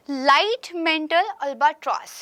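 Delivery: "light mantle albatross" sounded out very slowly, word by word; careful pronunciation. Shown as a demonstration. Teacher slowly sounding out the bird's name for students. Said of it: "if I am pronunciating it right".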